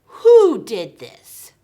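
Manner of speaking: slow, booming furious demand; angry king. Acted. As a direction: thunder it out as the outraged king. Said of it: "King Xerxes got very upset".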